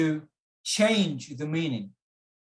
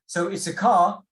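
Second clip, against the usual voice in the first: "car" louder and higher; stress on car; 4 semitones higher, 6 dB louder than usual. Shown as a demonstration. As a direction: punch the word "car". Teacher contrasts the stressed word.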